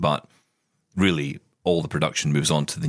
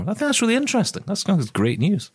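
high mock voice. Cohost chiming in mid-plug.

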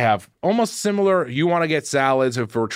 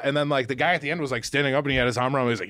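usual speaking voice

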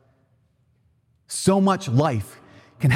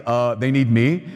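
God's reply; 4 semitones lower, slower than usual. Retold or imitated. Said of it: "And he's like".

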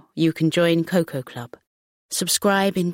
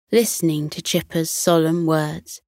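usual speaking voice

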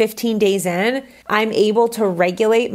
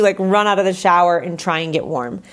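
ordinary delivery

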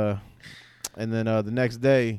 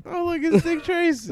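put-on teasing kids. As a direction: taunt it like classmates. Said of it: "repeating the classmates' line".

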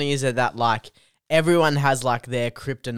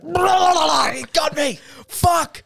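much higher, loud flustered shout; crumbling wail; 4 dB louder than usual. Retold or imitated. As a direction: babble and collapse in defeat. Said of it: "and I'd be like".

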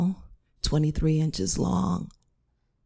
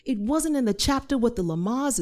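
ordinary delivery, nothing marked

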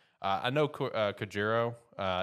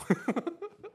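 high laugh; 3 dB louder than usual. Real, not acted. Laughing at teased fight.

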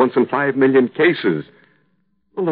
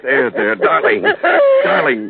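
soothing there there, gentle; husband soothing his crying wife; comforting; 3 semitones higher, 4 dB louder, slower than usual. Acted. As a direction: hush her tears tenderly.

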